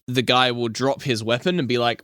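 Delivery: ordinary talk, nothing marked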